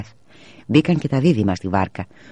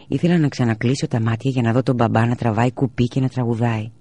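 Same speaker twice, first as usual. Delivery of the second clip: usual speaking voice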